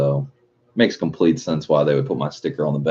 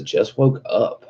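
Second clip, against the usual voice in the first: imitating sleepy Cam; 5 semitones higher, slower than usual. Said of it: "Cam says".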